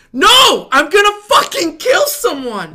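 high, very loud, drawn-out roar; explode, dragging out the threat; murderous rage. Retold or imitated. Waiter answering coworkers who find him hilarious, murderous shout. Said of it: "Murderous [name]".

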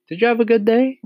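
put-on very high, friendly little-girl question; sweetly polite daughter, pitched right up; pleasant child. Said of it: "she said pleasantly".

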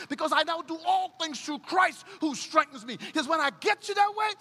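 high, loud building climax; preacher's climax voicing God; preaching at full force. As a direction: drive it home, climbing every line.

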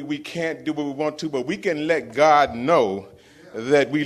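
loud, high full-force preacher; preaching. Real, not acted. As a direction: rouse the church from the pulpit. Preaching to the congregation.

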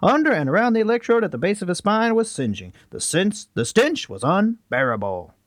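put-on high, dramatic old news reader; 1980s reporter voice. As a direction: read it like a vintage newsman. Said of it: "That's my nineteen eighties reporter".

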